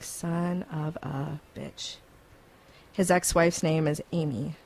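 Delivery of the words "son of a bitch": soft, very slow stunned curse; muttered curse. Retold or imitated. Host realising the name matches, stunned quiet curse. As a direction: let it drop out low in disbelief. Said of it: "I'm like".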